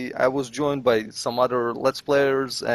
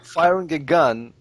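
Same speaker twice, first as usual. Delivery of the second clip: ordinary delivery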